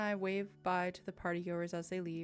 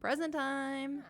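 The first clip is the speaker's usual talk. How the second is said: excited; 6 semitones higher, 4 dB louder, much slower than usual. Real, not acted. Player announces gift eagerly.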